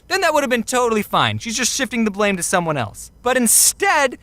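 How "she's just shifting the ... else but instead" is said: ordinary delivery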